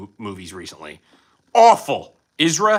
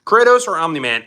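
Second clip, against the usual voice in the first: ordinary talk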